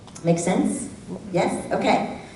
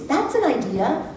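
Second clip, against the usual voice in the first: higher, rising "an" in "That's an idea."; 7 semitones higher than usual. Retold or imitated. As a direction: lift "an" gently. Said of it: "only heard the tone of voice".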